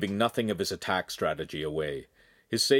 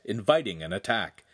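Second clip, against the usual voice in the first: usual speaking voice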